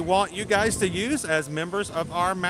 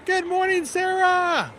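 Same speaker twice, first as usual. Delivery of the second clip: excited; 12 semitones higher, 3 dB louder, slower than usual. Real, not acted. Excited shout-out to a viewer.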